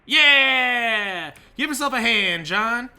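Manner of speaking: loud, high drawn-out whoop and cheer; mock cheering. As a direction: cheer John on at full volume. Cheers on his game character.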